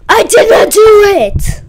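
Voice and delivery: loud, high, slow mock yell; imitating Kevin's yell. Kid mimicking a classmate denying it, mock yell. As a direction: yell the denial like a kid caught out. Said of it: "This is how he yells".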